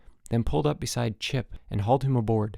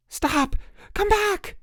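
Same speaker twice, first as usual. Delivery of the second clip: desperate yelling; much slower than usual. A narrator's reading. Counselor shouting while swimming after boat.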